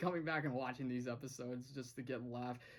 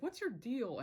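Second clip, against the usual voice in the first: higher, sharp demand; indignant; 7 semitones higher than usual. Retold or imitated. Gus confronting Matt, higher indignant demand. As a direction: confront him, voice pitched up. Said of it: "he's like".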